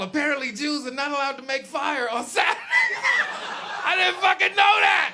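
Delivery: loud high laughing shout at the discovery; laughing disbelief. Real, not acted. Comedian revealing what he learned about the Sabbath, laughing loudly. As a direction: laugh it out, shocked and embarrassed at once. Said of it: "Not at all".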